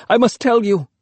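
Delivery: put-on a frightened boy begging to be heard; sobbing. A narrator's reading. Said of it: "I sobbed".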